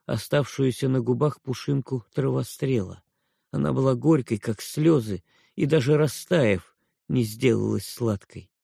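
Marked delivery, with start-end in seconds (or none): none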